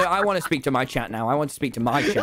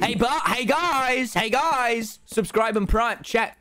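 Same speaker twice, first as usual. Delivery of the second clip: higher, calling out to the chat; rally the viewers in a raised voice; streamer plug; 6 semitones higher, slower than usual. Pretend. Streamer asking his chat for Primes, raised hype-up call.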